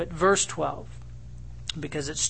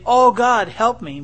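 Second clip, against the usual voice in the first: a loud, high cry of "Oh, God, help me"; mock the hollow plea of a hypocrite, louder; mock desperate prayer; 6 semitones higher, 9 dB louder than usual. Hypocrite crying out to God for show, mock loud plea. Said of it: "You could say".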